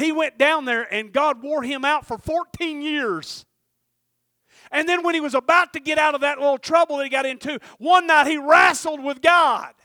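loud, high preaching cry; full-force preaching. Acted. Preacher calling the church to Jacob's story, loud and high. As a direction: preach it hard to the congregation.

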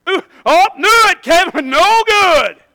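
high, loud wail of dread; exaggerated retold alarm; mock panic. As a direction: act out panicked thoughts, hammed up. Acts out his panicked thoughts.

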